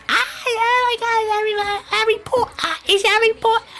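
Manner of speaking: very high squealing Harry Potter fangirl; hysterical teenage fangirl. Retold or imitated. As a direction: squeal like a teen girl losing it over an idol. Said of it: "you see these teenage girls".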